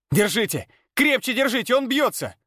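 high, panicked shouting. A narrator's reading. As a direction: yell at them to hold tight. Carriers shout during a seizure.

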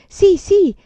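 put-on high, loud child's yes; excited cry. A narrator's reading. Child welcomes the proposed game, crying out excitedly. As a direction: cry out eagerly, pitch leaping up. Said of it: "gridò Promessa Costante".

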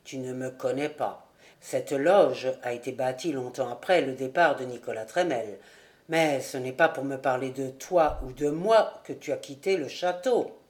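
put-on low, steady voice of a mysterious old charcoal burner; charcoal burner Rouan. A narrator's reading. Charcoal burner welcoming Jude into his hut, low and knowing.

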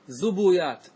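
زبويات as zu-bu-yat; three syllables in زبويات, "u" twice; forbidden vowel echo. Shown as a demonstration. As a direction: copy the "u" onto the ب in زبويات. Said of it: "ممنوع الإتباع".

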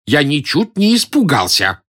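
slow, emphatic indignant denial. A narrator's reading. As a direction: stress on не. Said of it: "с негодованием воскликнул Близорукий". (с негодованием, медленно и с нажимом)

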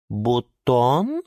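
hesitant БУТОН with a rising end; tentative, pensive verdict; thoughtful. A narrator's reading. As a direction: let бутон out slowly and unsurely. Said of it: "задумчиво сказала Лиана Диана".

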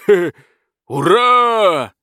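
high, loud cheer of relief; joyful shout. Acted. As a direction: whoop with delight. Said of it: "крикнул дракон".

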